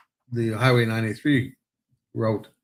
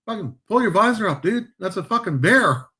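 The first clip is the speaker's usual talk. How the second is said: quoting buddy; 8 semitones higher than usual. Retold or imitated. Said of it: "He turns and looks".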